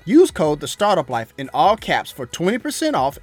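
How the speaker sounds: smooth sponsor read over music. Acted. Host reading a Manscaped sponsor spot, upbeat ad voice. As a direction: sell the razor like a podcast host ad spot.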